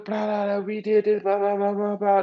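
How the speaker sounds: high monotone babble; mock rambling. Imitating the dude rambling on, high mock babble. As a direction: rattle off a high-pitched imitation rant. Mimicking the friend's drunken rambling.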